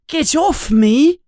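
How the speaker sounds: high, loud frightened yell. Acted. Harry wakes in the dark and yells at the shape by his bed. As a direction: shove the stranger away with your voice. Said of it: "yells Harry".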